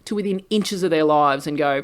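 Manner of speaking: usual speaking voice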